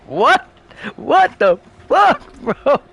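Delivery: high, slow, stretched disbelief; incredulous. Real, not acted. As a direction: draw it out in shock. Disbelief recalling the scene.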